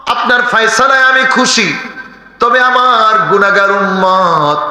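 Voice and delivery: high, half-sung sermon chant; chanted pulpit exhortation; melodic preaching. Acted. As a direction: intone it high, holding the phrase endings. Preacher chants dialogue with God.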